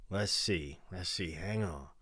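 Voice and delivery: quietly muttering while hunting through the chat; muttering to himself. Real, not acted. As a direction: trail off while searching. Scrolling chat, trailing off.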